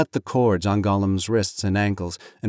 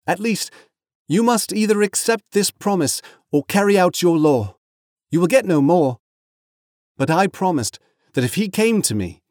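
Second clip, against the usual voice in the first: higher, firm plea; earnest Frodo; 9 semitones higher, 4 dB louder than usual. Acted. Frodo pleads Gollum's case to Faramir, earnest raised pitch. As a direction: argue for him, high-voiced and insistent. Narrator voices Frodo arguing.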